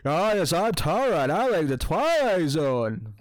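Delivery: imitating Tara. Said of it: "He was making you like".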